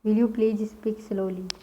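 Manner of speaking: whole sentence at a slow steady pace; teacher reading the finished sentence for notes; slow dictation. Shown as a demonstration. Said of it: "English में क्या बोला जाता है?".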